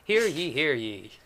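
slow, loud proclamation; town crier. Acted. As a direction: announce it slowly to the town square. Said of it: "That's a fun accent".

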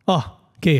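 ordinary talk, nothing marked

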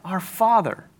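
soft, reverent opening of the prayer. Pretend. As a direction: say it hushed, like starting a prayer. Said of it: "when Jesus taught us to pray".

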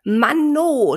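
put-on a child stamping his foot; stamping frustration. Little elephant fed up with the noise, loud annoyed outburst. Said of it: "stampfte der kleine Elefant auf".

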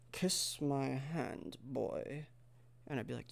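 very slow and soft; put-on seductress.

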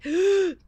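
a quick high gasp of confusion; sharp gasp. Retold or imitated. Guest telling the host how home feels, sharp gasp. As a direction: gasp as if caught off guard.

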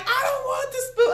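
high-pitched mock child protest; scared child. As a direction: squeal it like a kid dreading punishment. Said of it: "He be like".

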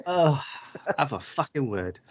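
usual speaking voice

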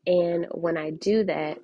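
ordinary talk

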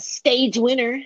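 slow, high, hammed-up anime hero; anime character voice. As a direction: drag it out like an anime dub. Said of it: "why'd you say it like that? You sound like a anime character".

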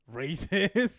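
loud high accusing shout; loud playful accusation; mock accusation.

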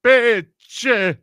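loud, high, drawn-out comic impression; Andrew Dice Clay. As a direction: belt it out like a brash stand-up, stretching the words. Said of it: "Is her boyfriend Andrew Dice Clay?".

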